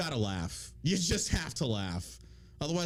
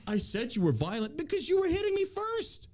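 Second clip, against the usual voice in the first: higher-pitched whiny mock protest; mock indignant protest; 13 semitones higher than usual. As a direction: protest in a high put-upon voice. Acting out accuser's indignant protest.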